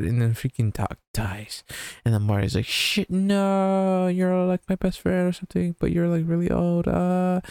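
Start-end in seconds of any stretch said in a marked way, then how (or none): 2.7-7.4 higher, half-hearted lament for Doc; mock grief. Marty laments his dead friend, higher mock grief. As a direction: fake mourning, pitched up, played for laughs.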